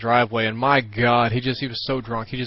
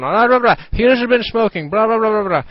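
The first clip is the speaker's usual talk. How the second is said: high, loud blah-blah parody of a ranting mother; nagging mom; 10 semitones higher, 7 dB louder than usual. Retold or imitated. The mother ranting about smoking, mocked high and loud. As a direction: rattle off her rant as shrill babble. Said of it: "she's going".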